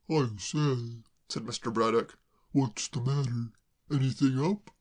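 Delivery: a hint of a gentleman, puzzled and kind; mild concern, lightly voiced; lightly voiced Braddock. A narrator's reading. Braddock asking the crying girl, mild concern.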